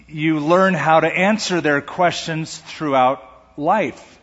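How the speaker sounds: normal talking voice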